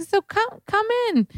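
put-on very high old-lady voice; elderly dermatologist. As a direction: imitate an old woman inviting someone over. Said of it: "the eighty-year-old derm who was like".

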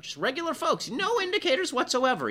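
high-pitched mock-innocent voice; mocking reporter. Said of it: "notice the way the reporter is phrasing that".

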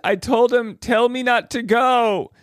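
high, drawn-out complaint; mock exasperation. Host quotes woman frustrated with her date, exasperated.

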